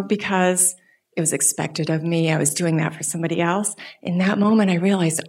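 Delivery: ordinary delivery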